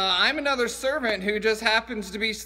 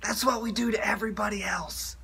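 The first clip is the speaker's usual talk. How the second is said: stage whisper; 4 dB quieter than usual. Acted. Skit: aside whisper.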